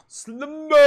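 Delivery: high startled outburst; sudden high yelp. Real, not acted. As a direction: blurt it out high and surprised. Reacting to the anime.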